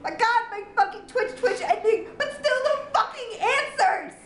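high, laughing exasperated rant; laughing in disbelief. Real, not acted. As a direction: laugh through the frustration. Husband laughing at her reaction.

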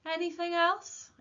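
high, loud, drawn-out wry question; wry remark. A narrator's reading. Postmaster to the boy at the counter, wryly. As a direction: stretch it out wryly, pitched high. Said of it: "he remarked wryly".

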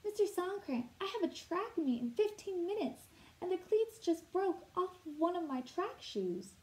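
put-on a panting kid in a rush; out of breath. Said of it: "all out of breath and vexed".

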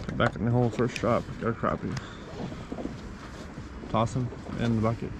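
ordinary talk, nothing marked

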